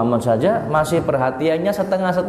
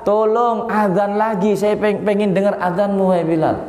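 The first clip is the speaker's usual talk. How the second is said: put-on high, small child's voice begging Bilal; child pleading; 9 semitones higher than usual. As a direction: plead like a young grandson, raised higher. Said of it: "Hasan Husain minta sama Bilal".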